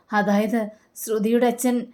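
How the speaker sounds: usual speaking voice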